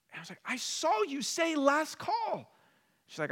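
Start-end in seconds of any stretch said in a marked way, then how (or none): 0.4-2.5 high, pressed arguing voice; indignant protest. Retold or imitated. Traveler protesting to the gate agent, high and indignant. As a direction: plead your case, outraged and high.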